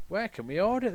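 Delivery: high-pitched wondering engine; high wondering quote. Acted. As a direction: ask it high and puzzled.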